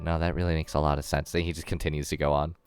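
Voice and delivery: normal talking voice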